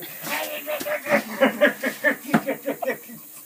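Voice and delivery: loud, high cackling laughter; big laugh. Real, not acted. Veteran roaring with laughter at his own joke.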